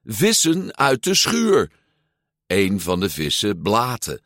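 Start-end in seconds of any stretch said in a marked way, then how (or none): 0.0-1.7 high, insistent loud call. A narrator's reading. Luca repeating the order to his fish, calling out. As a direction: shoo them out with a raised voice. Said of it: "riep Luca".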